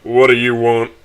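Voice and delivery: curt, put-on dad voice; curt father. A narrator's reading. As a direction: answer the phone unwillingly, lightly voiced dad. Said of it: "I hear is a deep sigh".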